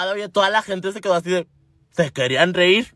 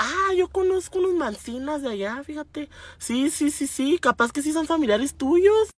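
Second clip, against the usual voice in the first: high, soft lady's voice; mock the older seatmate, higher and lighter; chatty lady; 6 semitones higher, 4 dB quieter than usual. Señora claiming to know the family, high and chatty. Acts the señora recognizing surnames.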